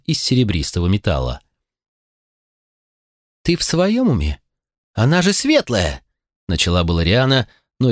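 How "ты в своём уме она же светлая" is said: high, outraged question; can't believe what she sees; indignant disbelief. A narrator's reading. Риана outraged at the elf. (возмущённое изумление)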